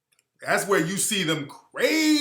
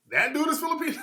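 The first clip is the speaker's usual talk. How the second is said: much higher, incredulous question; can't believe it; surprised disbelief; 10 semitones higher, faster than usual. Real, not acted. Host rechecking the rapper's background, high incredulous question.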